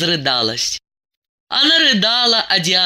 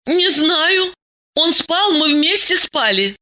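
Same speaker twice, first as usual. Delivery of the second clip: high-pitched, flustered babble; babbling; 12 semitones higher than usual. A narrator's reading. Diana defends her husband to Masha, babbling. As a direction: stammer out a helpless excuse in a high voice. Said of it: "залепетала Диана".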